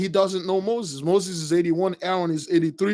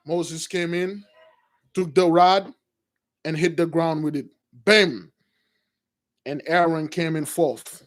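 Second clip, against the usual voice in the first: ordinary delivery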